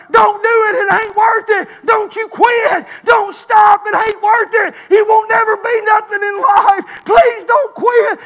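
acted high, loud pleading shout; hollering. Buddies begging a recruit not to quit, hollering. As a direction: holler as if calling back a buddy. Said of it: "their buddies would get hollering at them".

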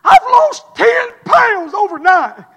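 high, loud and slow, words stretched out; hammed-up triumphant proclamation; imitated elated announcement. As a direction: crow it like a thrilled daughter. Daughter announcing weight loss, thrilled.

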